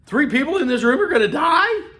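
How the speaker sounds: high, loud shocked question; shocked disbelief. Pretend. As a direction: gasp it out in disbelief. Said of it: "I mean, what?".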